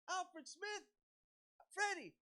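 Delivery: high-pitched holler of a name; yelling. Retold or imitated. Fan trying to get the singer's attention, yelling. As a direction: yell to catch someone's eye. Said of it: "like, yelling, like".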